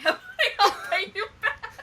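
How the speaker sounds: laughing through the words, pitch rising; laughing, pitch climbing high. Real, not acted. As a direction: giggle out an embarrassed confession. Laughing confession of returning game.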